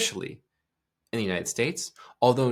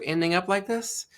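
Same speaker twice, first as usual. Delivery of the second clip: effeminate upward ending; 9 semitones higher than usual. Shown as a demonstration. Host showing how effeminate people speak, upward ending. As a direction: voice climbing high at the end. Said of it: "the way that the voice is used".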